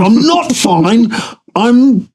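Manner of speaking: very high, slow, strained protest; panicked. Retold or imitated. Husband insisting to his wife he's not fine, high and panicky. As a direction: stretch it out, voice climbing in panic.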